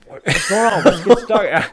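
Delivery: high, bright mimic of a breezy nurse, laughing through it; mimicking nurse. Soldier mimicking his nurse, high and laughing. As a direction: play the clueless nurse with a laugh. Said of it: "this nurse is just like".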